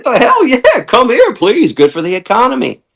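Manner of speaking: high, hammed-up cheerful yes-man, laughing through it; mock eager welcome. Mocking Canadian officials welcoming a business, high and laughing. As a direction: gush like an official who loves the money.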